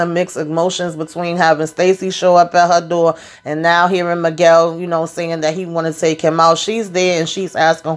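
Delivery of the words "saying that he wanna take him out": ordinary talk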